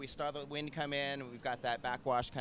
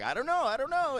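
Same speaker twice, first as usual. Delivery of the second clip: much higher, squeaky and unsure; high doubtful squeak; 14 semitones higher, 4 dB louder than usual. Retold or imitated. Watching live with Sam, a high squeaky worried reply. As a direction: pitch it way up, fretting over the score. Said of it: "and I'm like".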